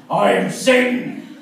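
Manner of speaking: slow, booming devil voice; hammed-up Satan announcing himself. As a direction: drag it out like the devil rising. Satan voice from the floor.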